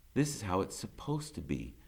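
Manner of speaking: usual speaking voice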